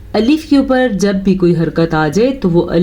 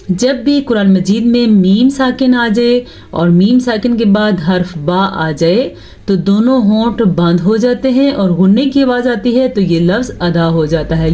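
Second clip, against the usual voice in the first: ordinary delivery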